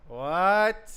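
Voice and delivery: loud, high disbelieving shout; shouted what. Real, not acted. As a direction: yell it in disbelief. Repeats in disbelief.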